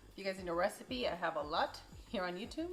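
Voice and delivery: normal talking voice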